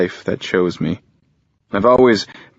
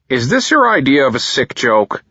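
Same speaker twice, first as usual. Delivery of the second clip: harsh father, higher and louder; 7 semitones higher, 5 dB louder than usual. Acted. Father accusing his son, harsh and scornful. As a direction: spit it out with contempt. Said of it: "with harsh intent".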